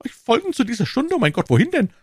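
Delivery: high-pitched, panicky questioning; stammering alarm. A narrator's reading. Prisoner asking the guards where to, high and panicked. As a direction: ask it in rising dread, voice very high. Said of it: "stammelte Bonacieux".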